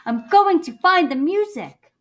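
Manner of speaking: put-on bright, eager little girl; excited. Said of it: "Annie said".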